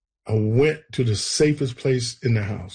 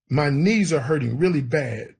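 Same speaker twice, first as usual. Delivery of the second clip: ordinary talk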